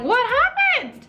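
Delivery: very high, drawn-out bewildered question; bewildered cry. Retold or imitated. Vlogger mimicking her own confused self, high and slow. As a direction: let it float up high in total confusion.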